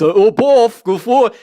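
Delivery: loud, high, excited imitation; Baba Booey voice. Host imitating him getting all excited, loud high mock voice. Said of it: "do the Baba Booey voice".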